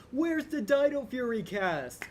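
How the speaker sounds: high, loud, drawn-out mock demand; mock pestering fan. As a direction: whine it loudly like an impatient fan spamming a company. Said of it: "like other people and be spamming Hasbro".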